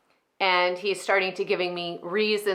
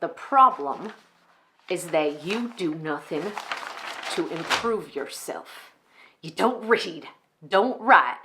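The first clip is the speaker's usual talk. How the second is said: measured, contemptuous church boss; Enid, narcissistic pastor. Acted. Enid cuts off her executive pastor's excuses, contemptuous. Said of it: "a character on an extreme end of narcissistic".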